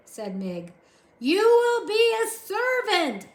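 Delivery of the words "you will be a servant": slow, high, loud shout. A narrator's reading. Soldier shouting slowly to deaf Mig. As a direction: bellow it word by word. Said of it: "shouted the soldier".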